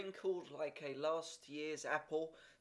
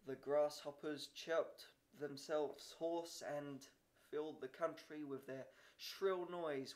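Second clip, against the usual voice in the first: ordinary delivery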